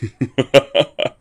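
rising, pained laugh; defiant laugh. A narrator's reading. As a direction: laugh through the blood. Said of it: "coughed up some blood and laughed".

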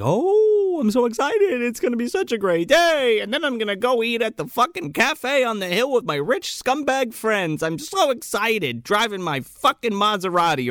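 high, hammed-up gushing excitement; mock a thrilled rich woman; ecstatic. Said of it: "ecstatic 'cause she".

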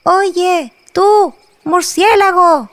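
high calling shout. Acted. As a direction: call up to the bat in the tree. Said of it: "Odé le gritó".

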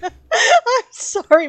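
laughing through the apology; can barely get the words out for laughing; giggling apology, slowed by laughter. Real, not acted.

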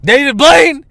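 a son mimicking his disappointed dad, loud and high; mock dad scolding. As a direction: bark at your son like a fed-up dad. Voicing dad scolding him.

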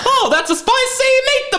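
very high, hammed-up Italian accent; mock Italian accent. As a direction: exclaim like a cartoon Italian. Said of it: "That's Italian".

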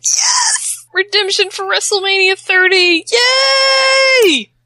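high, loud cheering yell of triumph; excited shout. Retold or imitated. Fan cheering her hero's win, excited shout. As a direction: shriek it out, thrilled for redemption. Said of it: "This is where I was like".

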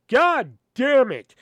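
slow, loud, high, drawn-out curse; frustrated curse. Retold or imitated. When the power cuts out in the theater, a slow loud curse. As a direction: let it ring out slowly in the silent room.

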